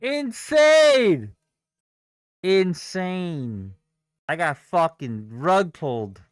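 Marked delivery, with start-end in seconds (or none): 0.1-1.3 high, loud, drawn-out cry of disbelief; stunned shout. Real, not acted. Trader watching the price crash, loud stunned cry. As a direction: let it burst out in shock. Reacting to stock collapse.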